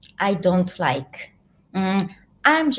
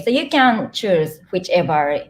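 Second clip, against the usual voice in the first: usual speaking voice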